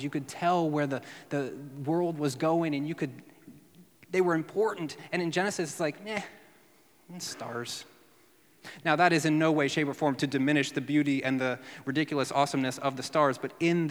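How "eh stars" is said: offhand, unimpressed; dismissive shrug. Pretend. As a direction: toss it away with a shrug. Genesis shrugging off the stars.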